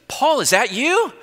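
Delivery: high, drawn-out questioning call; calling back. Retold or imitated. Woman answering the visitor at her screen door, calling back. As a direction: imitate a woman calling from indoors.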